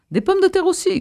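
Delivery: very high-pitched call down to a crowd; crying out. A narrator's reading. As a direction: strain upward to be heard below. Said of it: "cria d'en haut le maire de toutes ses forces". (cri haut perché, lancé d'en haut)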